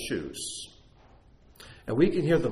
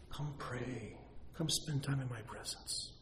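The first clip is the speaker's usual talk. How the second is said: much softer, gentle invitation; still small voice; 2 semitones higher, 12 dB quieter than usual. Retold or imitated. Pastor voicing God's small voice, soft and inviting. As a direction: call them quietly to prayer. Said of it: "that little voice says".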